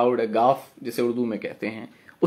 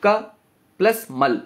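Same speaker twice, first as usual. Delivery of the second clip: क short and separate, then मल as one unit; क and मल set apart; syllable breakdown; 5 semitones higher, slower than usual. Shown as a demonstration. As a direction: spell out the split क, pause, plus मल. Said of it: "कमल को जब हम तोड़ेंगे".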